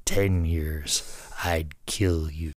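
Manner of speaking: slow, low menace sinking to a whispered threat; lightly voiced old wrestler, breathless with laughter at the end; Harley Race voice. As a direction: let the threat fade to a whisper on I'd kill you. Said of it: "in my best Harley Race voice".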